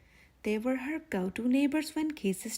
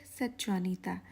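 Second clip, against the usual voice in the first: ordinary delivery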